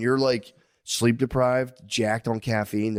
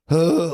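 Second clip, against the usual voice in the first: loud, higher tired huff; exhausted groan; 6 semitones higher, 6 dB louder than usual. Retold or imitated. Dad lying in bed, a heavy exhausted huff. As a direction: let out a heavy worn-out huff. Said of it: "I'm like".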